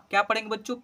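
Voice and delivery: normal talking voice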